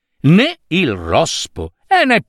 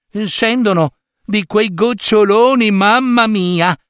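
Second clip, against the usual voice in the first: mamma snail marvelling, pitched higher; higher, lightly amazed mamma snail; lightly voiced mother snail; 8 semitones higher than usual. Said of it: "aggiunse mamma lumaca". (mamma lumaca stupita, voce più acuta)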